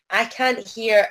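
normal talking voice

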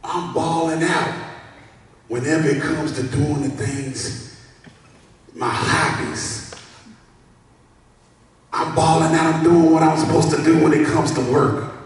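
high, loud bragging; boastful. Acted. Preacher voicing someone proud of work, boastful. Acting another self-praising person.

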